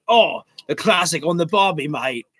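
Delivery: clichéd mock-Aussie accent; Aussie cliché put on for a joke; Australian accent. Shown as a demonstration. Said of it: "if I attempted an Australian accent".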